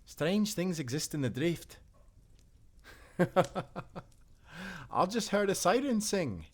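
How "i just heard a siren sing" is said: laughing in disbelief. Acted. Sailor marvels at siren.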